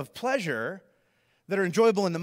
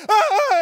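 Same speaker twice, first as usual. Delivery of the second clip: high, loud mock scream; hammed-up yell of alarm; startled scream; 10 dB louder than usual. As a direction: scream as if pelted. Wrappers thrown at him.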